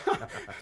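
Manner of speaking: brief unvoiced scoffing laugh; a quick amused puff of a laugh; a short airy chuckle; short breathy laugh. Scoffing at the claim.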